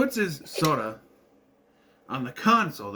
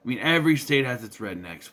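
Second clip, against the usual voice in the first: usual speaking voice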